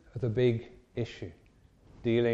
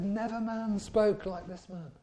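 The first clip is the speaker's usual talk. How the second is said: raised, wondering testimony; awestruck guards; 7 semitones higher than usual. Retold or imitated. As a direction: marvel at what you heard. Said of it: "And they said".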